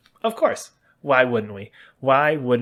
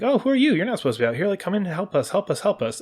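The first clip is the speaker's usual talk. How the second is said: friendly villager; 9 semitones higher, faster than usual. Retold or imitated. Villagers welcoming you, voiced.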